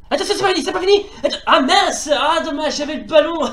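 real laughing excitement; excited. Real, not acted. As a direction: giggle through the near-miss.

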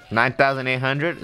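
normal talking voice

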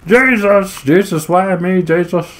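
whiny enemy. Retold or imitated. Gamer mimics the enemy he killed, a hammed-up high whine. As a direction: pitch up and whine like a sore loser. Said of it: "He's just like".

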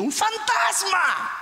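high, drawn-out scream of alarm; acted high-pitched shriek of fright; frightened cry. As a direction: cry out as if seeing a ghost. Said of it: "Imagínense el grito".

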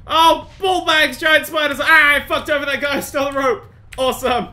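loud, high outburst; alarmed yelling. Real, not acted. Player meeting giant spiders, alarmed yelling. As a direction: shout it out in shock.

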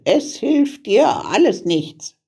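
high, dismissive witch voice; scornful witch. A narrator's reading. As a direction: taunt the crying child as a witch. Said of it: "sagte die Hexe".

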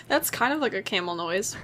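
usual speaking voice

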